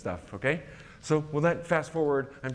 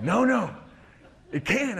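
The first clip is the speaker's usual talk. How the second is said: higher and louder, thrilled realization; excited exclamation; 9 semitones higher, 5 dB louder, slower than usual. Retold or imitated. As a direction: burst out that it can work. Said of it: "I said".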